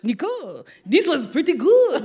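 put-on lightly done French accent, rising at the end; a hint of a French husband praising the cake; playful French accent with a laugh in it. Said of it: "But I do an amazing French accent".

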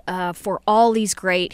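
ordinary talk

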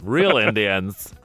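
slow, drawn-out Southern drawl; mock Nashville accent. Coworker in Nashville scoffing, slow Southern drawl. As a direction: drag it out like a Tennessee local. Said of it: "they talk like this in Nashville".

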